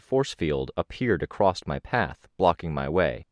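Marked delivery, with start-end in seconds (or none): none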